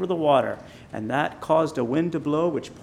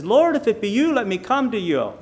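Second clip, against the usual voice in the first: imitating a high, raised call to Jesus; lightly acted plea, pitched up; man calling out; 8 semitones higher, 5 dB louder than usual. Man on the boat calling to Jesus, retold plea.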